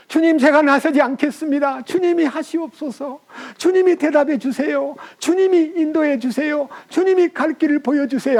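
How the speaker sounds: high, earnest plea to the Lord; pleading prayer. Pretend. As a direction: beg the Lord to lead, voice lifted high. Preacher voices a pleading prayer. (높고 간절한 기도)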